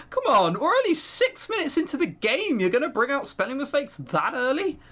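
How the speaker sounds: normal talking voice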